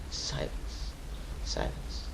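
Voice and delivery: a breathless whisper of the word; whispered silence. Real, not acted. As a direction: let it fade to a whisper. Describing the uncle's silence.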